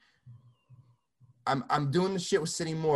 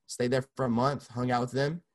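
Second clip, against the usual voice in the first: ordinary talk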